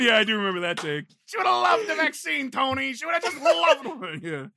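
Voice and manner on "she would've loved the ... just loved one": high, loud exasperated yell; shouted outburst. Acted. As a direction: shout it high, dragging out the end. Re-performs skit outburst.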